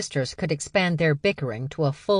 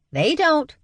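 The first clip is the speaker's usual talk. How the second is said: snippy; 13 semitones higher, 5 dB louder than usual. Acted. Sisters snipe at each other.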